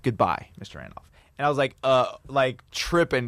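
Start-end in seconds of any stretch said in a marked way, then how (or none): 0.0-1.0 dropped-pitch goodbye; low sign-off. Retold or imitated. Comedian ends the call with the caller, low-pitched. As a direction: imitate a formal, final farewell.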